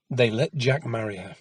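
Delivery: ordinary delivery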